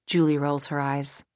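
ordinary delivery

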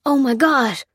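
high, loud exclamation; alarmed outcry; shocked. A narrator's reading. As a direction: react in horror at the sight. Shocked at sight of wounded woman.

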